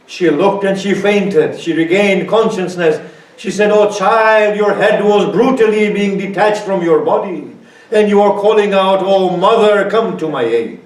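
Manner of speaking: high, loud wailing narration; preacher wailing the grandmother's vision, loud and high; climactic lament. Acted. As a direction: build it to a full cry of grief.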